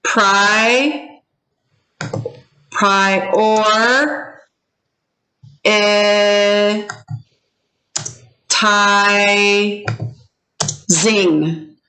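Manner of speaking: each syllable held long, with pauses between; broken into syllables. Shown as a demonstration. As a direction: isolate and sustain every syllable. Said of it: "break this up into syllables".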